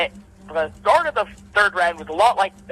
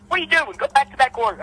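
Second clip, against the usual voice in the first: higher, sharp call to stop; ref calls out; 8 semitones higher than usual. Retold or imitated. Referee scolding boxer for wrong corner, sharp raised call. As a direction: snap at the fighter to go back. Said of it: "and the ref goes".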